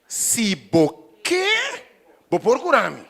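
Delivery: a begging prayer voiced for the church; high, loud, drawn-out pleading prayer. Shown as a demonstration. Preacher voicing a believer pleading to the Lord, loud and drawn out. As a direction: stretch se você up high, then cry out vou procurar-me. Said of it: "e diz, Senhor".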